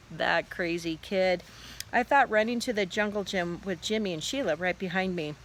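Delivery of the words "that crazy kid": usual speaking voice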